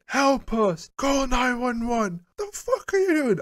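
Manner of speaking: strained, squeaky re-enacted yelling, held back in volume; retold screaming. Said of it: "I'm, like, screaming at it".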